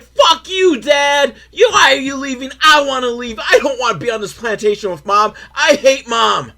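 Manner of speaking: mock tantrum of a tearful kid, high and loud; hammed-up shrieking child raging at a parent; angry crying. Kid raging at Dad for leaving him with Mom, mock angry crying. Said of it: "He's angry crying. He's like".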